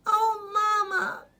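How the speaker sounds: put-on high, loud child's cry of dismay. Boy calls to his mother after eating, loud and high. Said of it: "Zaki cried out".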